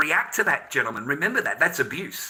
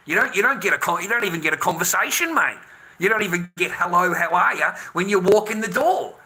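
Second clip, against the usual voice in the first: raised, exasperated complaint, louder towards the end; indignant rant; 3 semitones higher, 5 dB louder than usual. Real, not acted. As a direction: build up in frustration, pushing louder. Indignant rant about being ignored.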